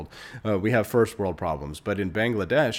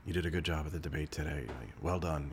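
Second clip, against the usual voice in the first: lightly imitating Teller, softer and faster; a soft, quick, mild hint of Teller; quiet Teller quote; 4 semitones lower, 9 dB quieter than usual. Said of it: "he actually talks very quietly in real life".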